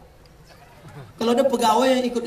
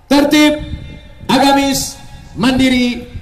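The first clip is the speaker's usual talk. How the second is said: proclaiming loudly; 6 semitones higher, 7 dB louder, slower than usual. Acted. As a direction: ring out each word of the motto, high and loud. City motto proclaimed. (meneriakkan semboyan kota dengan lantang)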